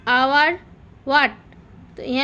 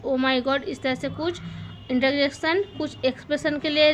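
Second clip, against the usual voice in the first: ordinary delivery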